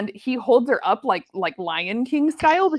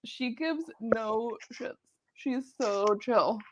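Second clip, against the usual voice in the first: slow, much softer, dry deadpan; deadpan reply; 10 dB quieter, much slower than usual. Retold or imitated. Owner answering her friend, slow and deadpan. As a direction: drop it flat and slow, unimpressed.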